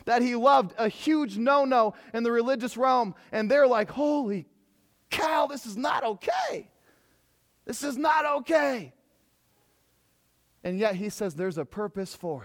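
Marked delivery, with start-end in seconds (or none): 3.9-8.9 higher, slow mock shout of alarm; shocked outcry. Disciples protesting as Jesus strips, mock outcry. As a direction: cry out like scandalized disciples.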